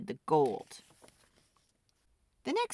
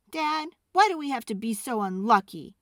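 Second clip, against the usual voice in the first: higher, louder blurt; 6 semitones higher, 6 dB louder than usual. Acted. Boy blurting to his father, louder. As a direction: let it burst out. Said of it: "he blurted out".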